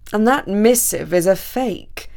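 slow, weighty pronouncement; lightly voiced Count, measured and firm; declarative Count. A narrator's reading. Count exposes the forged message, slow and certain.

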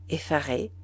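plain reading voice

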